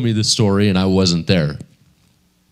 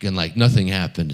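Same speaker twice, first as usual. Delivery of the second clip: ordinary talk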